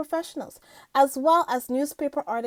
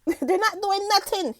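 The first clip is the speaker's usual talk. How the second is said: higher-pitched light imitation of the singer; 7 semitones higher than usual. Host retelling the singer's interview answer, lightly imitated dismissal. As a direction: quote his dismissal with a hint of impersonation. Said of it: "he said".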